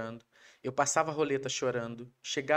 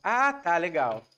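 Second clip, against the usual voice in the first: offhand dismissal; 3 semitones higher, 8 dB louder, much slower than usual. Retold or imitated.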